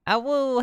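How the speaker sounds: high playful howl back; howling like a wolf pup. Retold or imitated. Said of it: "echoes chat's Awoo".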